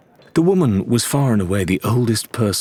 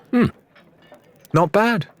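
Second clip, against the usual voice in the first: diplomatic; 14 semitones higher than usual. A narrator's reading. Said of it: "she said diplomatically".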